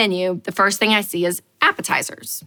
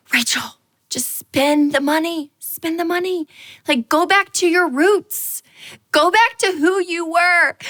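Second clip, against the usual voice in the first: higher, slow coaxing to herself; self pep talk; 7 semitones higher than usual. Retold or imitated. Coaxing herself to spend the money, higher and slow. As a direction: talk yourself into splurging.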